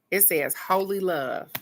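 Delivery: ordinary talk, nothing marked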